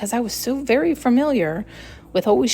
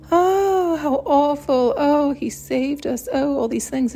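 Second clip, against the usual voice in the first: mock dramatic gasp; 5 semitones higher, slower than usual.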